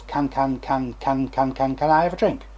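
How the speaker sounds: even word repetitions on a steady intonation; demonstrated effortless stammer; steady disfluency. Shown as a demonstration. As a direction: repeat the first word evenly, no sign of struggle. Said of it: "Steady intonation".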